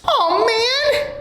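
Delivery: high whiny wail; whining. Acted. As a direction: whine it out in despair. Punishment grows again.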